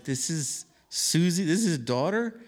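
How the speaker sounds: wondering aloud in awe; amazed disbelief. Retold or imitated. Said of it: "I was like".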